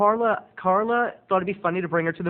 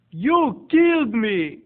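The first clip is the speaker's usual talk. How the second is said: high, loud, slow accusation; hammed-up morbid accuser, stretching each word; imitated eccentric aunt; 6 semitones higher, 5 dB louder, much slower than usual. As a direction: accuse them dramatically in the odd aunt voice. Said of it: "she talks like this".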